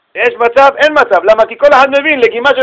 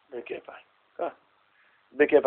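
whispered grudging yes; 7 semitones lower, 14 dB quieter than usual. Retold or imitated. Retold grudging yes, unvoiced.

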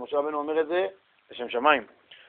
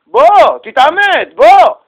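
higher, louder challenge to come fight; goading taunt; 10 semitones higher, 10 dB louder, much slower than usual. Retold or imitated. Evil urge goading the man into fighting, loud. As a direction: egg him on loudly.

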